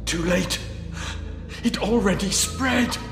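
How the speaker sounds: high-pitched, pained panic; desperate and high; panicked despair. Acted. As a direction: despair as the poison takes hold. Poison victim despairs as venom spreads.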